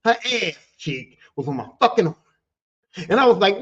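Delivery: ordinary talk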